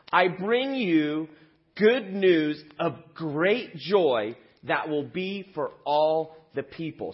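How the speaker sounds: slow, grand angel's announcement; slow proclamation. Acted. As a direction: draw out the good news. Angel's announcement read aloud.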